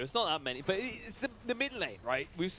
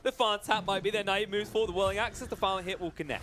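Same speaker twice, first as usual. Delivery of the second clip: excited; 5 semitones higher, 4 dB louder than usual. Real, not acted. Caster excited over teamfight.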